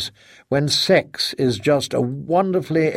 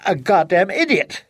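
furious wife; 4 semitones higher than usual. Acted. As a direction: lash out with hurt and rage. Wife accuses husband bitterly.

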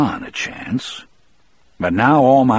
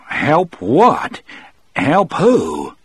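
higher, indignant questions; incredulous challenge; 6 semitones higher, 3 dB louder, much slower than usual. A narrator's reading. Moores answers Harry's offer with gun raised, incredulous challenge. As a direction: throw the word back at him in disbelief.

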